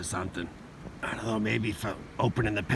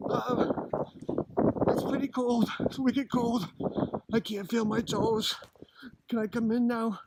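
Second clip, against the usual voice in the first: higher, louder freezing reporter in a storm; drenched and cold; 12 semitones higher, 6 dB louder than usual. Pretend. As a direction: shout it through the wind and cold.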